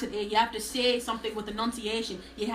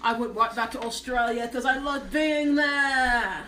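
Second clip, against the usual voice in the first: put-on Californian-Australian accent. Declaring love for Australia, Californian-Australian accent. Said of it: "I sound like a Californian Australian".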